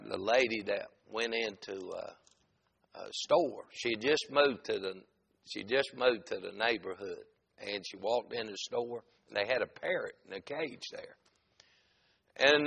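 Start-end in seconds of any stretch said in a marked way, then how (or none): none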